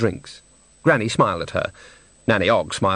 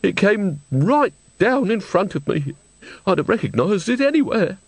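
put-on tearful old woman; 9 semitones higher than usual. A narrator's reading. Old woman tells the witches what fell before her, tearful. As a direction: recall it plaintively, high-pitched.

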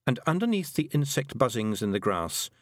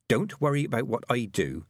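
usual speaking voice

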